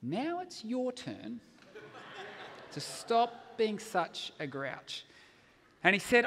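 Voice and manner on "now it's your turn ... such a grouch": put-on very slow, deliberate child's challenge; daughter turning tables. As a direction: hand down the verdict slowly as a small child. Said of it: "And she says".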